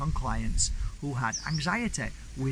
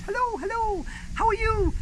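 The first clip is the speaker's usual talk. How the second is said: high, bright-voiced Irish greeting, louder; Irish accent, sing-song hello; Irish lady; 5 dB louder than usual. Village lady greeting Mark in the street, high Irish accent. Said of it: "she was an Irish lady".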